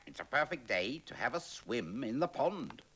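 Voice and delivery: chirpy duck off for a swim. Acted. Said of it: "thought the duck".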